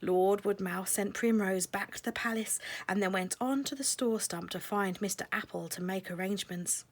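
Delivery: usual speaking voice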